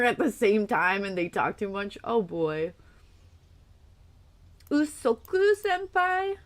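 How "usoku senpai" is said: cutesy anime voice. Pretend. Cutesy anime question to chat.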